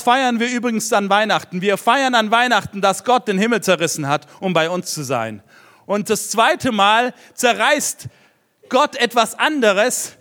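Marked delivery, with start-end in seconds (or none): 5.9-10.1 slowed down to weigh each word; slow emphatic. Real, not acted. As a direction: spell it out deliberately. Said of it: "emphasising again". (langsam und eindringlich)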